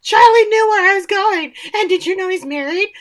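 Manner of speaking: high, loud, rushed alarm; blurting out. Retold or imitated. Andy on the phone to Gordon, blurting in high alarm. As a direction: spill it all out in a panic. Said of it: "starts blurting out".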